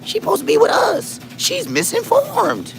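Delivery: very high squealing disbelief; mock outrage. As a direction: squeal it as scandalized friends. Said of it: "we be like".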